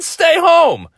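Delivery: high furious scolding of his mom; mock furious order. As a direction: bark it at your mother. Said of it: "I said".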